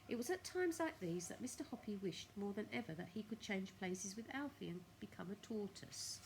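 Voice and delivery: ordinary delivery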